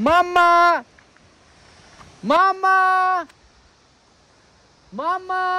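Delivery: high, loud call across the valley; calling out for echo. Real, not acted. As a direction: shout to hear the echo. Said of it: "একটুখানি আওয়াজ দিয়ে দেখি তো শোনা যায় কিনা".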